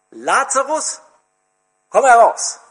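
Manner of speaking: commanding shout into the tomb; loud call. Acted. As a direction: call the dead man out of the grave. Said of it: "rief er mit lauter Stimme".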